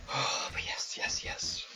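soft, unvoiced yeses; breath-only, savouring whisper; whispered relish. Real, not acted. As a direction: whisper it to yourself. Savouring the game alone.

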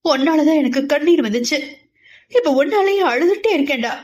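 put-on a young woman's heartbroken, tearful reproach; anguished crying. A narrator's reading. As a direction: plead through the pain of a broken heart. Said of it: "மனதின் வேதனை தாங்க முடியாமல்".

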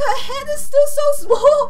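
ordinary delivery

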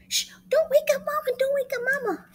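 high urgent whispered hush. Acted. Monkey hushing siblings as the fire engine comes, high urgent. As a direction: shush them urgently, high.

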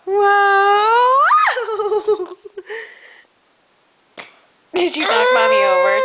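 high, loud laughing whoa; laughing shout. Real, not acted. Mother knocked over by toddler, laughing shout.

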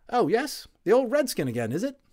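a WWI orderly waking up chatty; friendly Cockney Tommy, loud and high; cheery Cockney medic. Acted.

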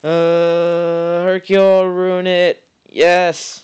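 high, loud eager cheering; root for the pull, then cheer; excited. Real, not acted. Waiting on summon result.

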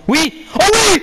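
high, loud yell of joy; excited shout. Real, not acted. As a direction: explode with delight at the goal. Goal chance during match.

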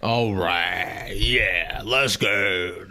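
low, sinister villain drawl. Acted. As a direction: play it like a scheming villain. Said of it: "What is that voice I'm doing?".

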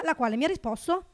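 ordinary talk, nothing marked

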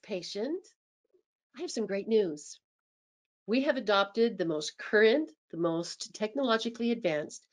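soft, low, welcoming voice; positive and caring. Shown as a demonstration. Receptionist welcoming patient back, soft and reassuring. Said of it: "make sure that our tone of voice, it's critical".

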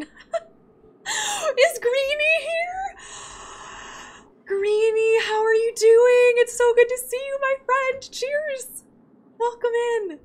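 squealing with delight at seeing Greenie; gushing greeting. Real, not acted. Greeting a favorite companion gushingly.

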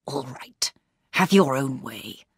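low, whispered angry surrender; whispering in suppressed anger. A narrator's reading. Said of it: "then whispered angrily".

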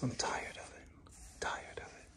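soft whispered protest; whispered complaint. Real, not acted. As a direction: whisper your grievance to the viewers. Whispered mock complaint to viewers.

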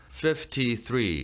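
"Fifty-three" in separate, blunt syllables; "Fif. Ty. Three", piece by piece; choppy, syllable by syllable. Shown as a demonstration. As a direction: clumsily sound out each part of "Fifty-three". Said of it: "이렇게 하나하나 투박하게 발음하지 마시고요".

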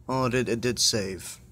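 ordinary delivery

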